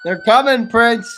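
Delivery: loud urgent call to Prince; hammed-up loud warning; calling out to Prince as the police come; mock warning call.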